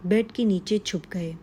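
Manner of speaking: plain reading voice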